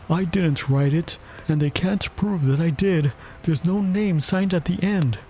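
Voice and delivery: high-pitched pleading defendant; knave. A narrator's reading. Knave appealing to his majesty, high pleading voice. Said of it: "said the knave".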